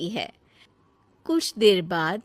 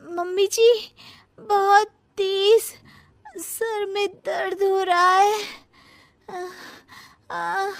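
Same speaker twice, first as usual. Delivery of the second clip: whiny pain; 9 semitones higher than usual. Acted. Daughter-in-law fakes headache pain.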